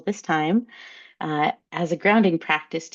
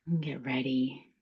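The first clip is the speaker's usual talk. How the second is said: whispered; 3 semitones lower, 8 dB quieter than usual. Shown as a demonstration. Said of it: "breathing cue, whispered".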